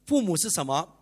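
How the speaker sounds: normal talking voice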